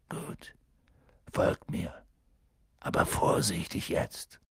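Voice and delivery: low, near-whispered order; hushed warning. A narrator's reading. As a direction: warn them quietly to go carefully. Warning companions while stalking. (leise, fast geflüsterte Warnung)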